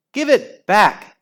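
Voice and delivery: high, loud demand; shout. Acted. Inky Ian demanding his pen, shouting. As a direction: yell at the thief. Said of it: "shouted Inky Ian".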